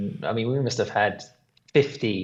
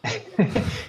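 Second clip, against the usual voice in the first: laugh; 10 semitones higher, 3 dB quieter than usual. Real, not acted. Laughing at own swearing.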